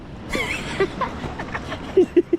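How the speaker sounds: high, mostly voiceless laugh. Real, not acted. Fisherman amazed at the amount, high laughter.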